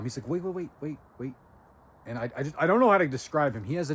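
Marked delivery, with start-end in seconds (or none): 0.3-1.3 quietly imitating a calm colleague; a hint of a soothing calm voice. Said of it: "he stayed very calm".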